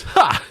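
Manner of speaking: loud mock kiai; karate yell. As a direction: shout a quick kiai. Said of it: "Just laugh in her face".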